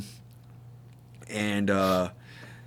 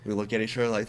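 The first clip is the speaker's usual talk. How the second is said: usual speaking voice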